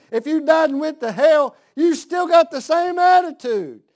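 fiery pulpit build; preach. Acted. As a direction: ride the rising fervor up. Preacher rising to a climax.

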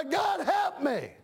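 slow, high, shouted plea; crying out to God. Shown as a demonstration. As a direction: cry it out slowly, begging for help.

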